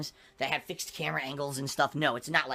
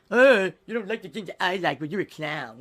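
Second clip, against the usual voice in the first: spoiled brat voice; 6 dB louder than usual. Retold or imitated. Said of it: "act like a little spoiled brat".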